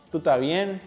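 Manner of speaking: tú tá bien, fully contracted; linked words in tú estás bien. Shown as a demonstration. Teacher's final practice take, tú estás bien linked smoothly. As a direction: run tú estás bien into one smooth unit. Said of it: "The words connect smoothly".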